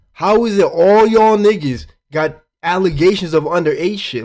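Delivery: loud, rising disbelief at them all; exasperated loud rant. Real, not acted. Streamer ranting to chat about allegations, loud exasperated outburst. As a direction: nearly shout it in disbelief.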